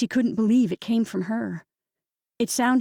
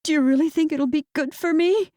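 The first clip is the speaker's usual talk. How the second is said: anxious; 7 semitones higher, 3 dB louder than usual. A narrator's reading. Anxious question to sister.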